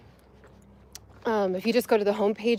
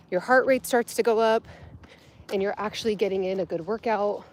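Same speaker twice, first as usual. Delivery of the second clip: ordinary delivery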